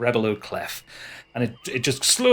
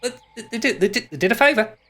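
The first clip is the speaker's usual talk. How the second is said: high, stammering little creature voice; high-pitched, faltering sidekick; excitable hoofed creature; 10 semitones higher than usual. Acted. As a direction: pipe up high, tripping over the words. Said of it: "Sod kind of pops up with".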